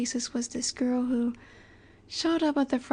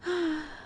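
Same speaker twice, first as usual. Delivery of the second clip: soft sigh; 4 semitones higher, 5 dB quieter than usual. Acted. Sighs at the listener's doubt.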